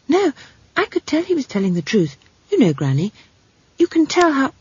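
put-on high-pitched child's protest; insistent young girl. Girl insists the wizard told truth, high and insistent. Esk argues with Granny.

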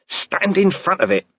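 ordinary delivery, nothing marked

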